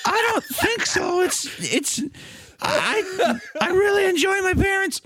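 high panicky Buster voice; high squeaky Buster. Acted. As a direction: squeak it out like a scared kid. Buster voice in comic bit.

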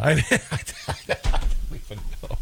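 breaking up into breathy laughter; words dissolving into a helpless laugh. Real, not acted. Host cracks up at his own Gotti family line, laughing.

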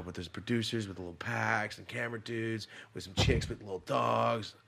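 usual speaking voice

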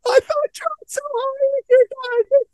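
high, slow mock mom voice. Imitating his mom scolding him, high mock mom voice. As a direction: squeak it out like a fussy mom. Mocking mom's voice.

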